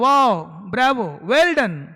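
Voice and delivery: loud, slow, delighted "वाओ, Bravo"; enthusiastic praise. Shown as a demonstration. Teacher showing how we praise a student who passed, delighted. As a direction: cheer a student's success warmly. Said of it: "तो हम लोग क्या बोलते हैं?".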